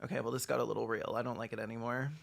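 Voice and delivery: ordinary talk, nothing marked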